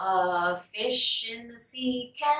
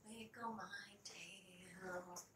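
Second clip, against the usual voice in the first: whispered echo; 7 semitones lower, 28 dB quieter, much slower than usual. Shown as a demonstration. Whispered echo of sung line.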